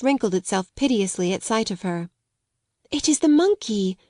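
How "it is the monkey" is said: higher excited exclamation; a glad cry spotting the monkey; cried out. A narrator's reading. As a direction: call out in delight at the discovery. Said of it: "she cried out".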